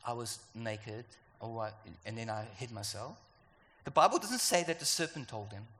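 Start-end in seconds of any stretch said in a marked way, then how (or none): 0.0-3.2 ashamed Adam. Acted. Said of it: "when he says".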